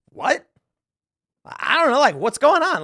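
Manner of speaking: high-pitched baffled reaction; puzzled, high questioning; mock bewilderment. Imagined baffled reaction of a stranger.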